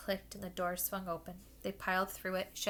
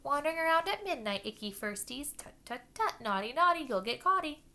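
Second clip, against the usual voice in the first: high, loud taunting sing-song; cackling poltergeist; 8 semitones higher, 7 dB louder than usual. Acted. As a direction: tease like a gleeful poltergeist. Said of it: "Peeves cackled".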